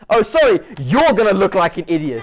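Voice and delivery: loud, high mock outrage; loud mock-offended retort; mock indignant. Real, not acted. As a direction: throw it back loud and high in fake offense.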